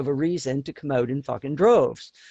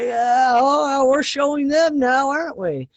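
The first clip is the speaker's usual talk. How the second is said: higher, louder mock boast, pleased with ourselves; mock triumphant; 8 semitones higher, 4 dB louder than usual. Canadians mocking their own protest, mock proud. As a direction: crow sarcastically about showing them. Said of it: "We'd just be like".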